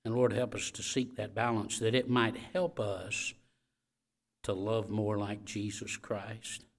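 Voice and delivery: hushed pastoral prayer; closing prayer. Real, not acted. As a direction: pray it gently over the room.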